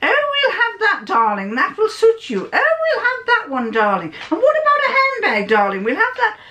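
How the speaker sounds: swan about spending freely, high and grand; posh lady. Retold or imitated. Rich mother to her daughter in Harrods, high posh voice. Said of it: "very posh".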